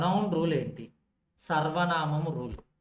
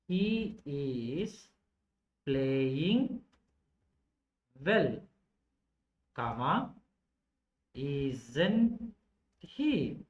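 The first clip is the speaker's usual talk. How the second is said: slow dictation, each word set apart with pauses; much slower than usual. Shown as a demonstration. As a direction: dictate it slowly, one word at a time. Writing out the example word by word.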